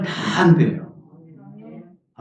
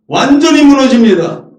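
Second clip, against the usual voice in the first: higher and louder declaration; emphatic cry; 8 semitones higher, 7 dB louder than usual. Real, not acted. Preacher proclaiming the woman's collapse, higher and louder.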